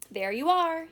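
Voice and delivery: high, loud cry of triumph; gleeful and proud, ringing out; triumphant cry. Acted. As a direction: crow it like you've just won. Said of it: "he cried triumphantly".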